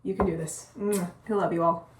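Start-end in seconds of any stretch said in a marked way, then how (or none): 0.8-1.1 kiss sound. Real, not acted. Blowing a kiss to viewers.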